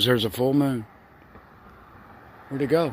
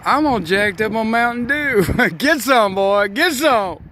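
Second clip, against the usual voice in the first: movie quote; 8 semitones higher than usual. Retold or imitated. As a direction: play the wired movie character yelling. Quoting Ricky Bobby movie lines.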